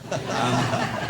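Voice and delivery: short real chuckle; laugh. Real, not acted. Laughing after reading his email. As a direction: laugh at own joke.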